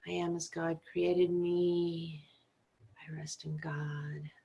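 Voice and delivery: slow recitation, fading to a near-whisper; hushed affirmation. Acted. Meditation teacher reciting a sacred affirmation, slow and hushed.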